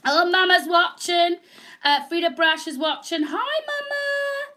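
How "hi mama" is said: high, slow, drawn-out greeting; high sing-song hi. Real, not acted. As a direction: call out hello to mum. Greeting mother watching.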